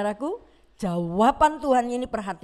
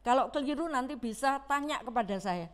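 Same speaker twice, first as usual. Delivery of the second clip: usual speaking voice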